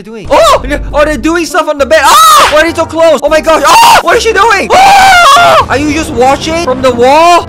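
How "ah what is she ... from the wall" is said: high, loud shocked scream; scandalized loud shriek. Real, not acted. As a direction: scream in outrage at the screen.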